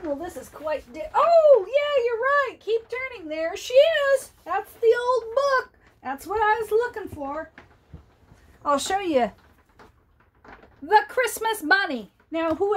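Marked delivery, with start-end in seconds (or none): 1.1-7.5 high, bright relief at finding it; oh, there it is!; delighted discovery. Real, not acted. Grandma finds the book she was hunting for, high and delighted.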